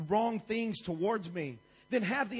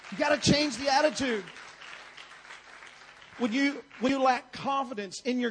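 loud, punchy preacher's push; forceful exhortation; 4 semitones higher, 4 dB louder than usual. Real, not acted. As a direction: drive it home to the whole room. Urging the congregation at full force.

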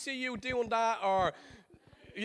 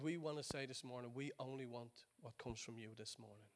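prayer; 8 semitones lower, 8 dB quieter than usual. Acted. Pastor praying over the congregation, soft and low.

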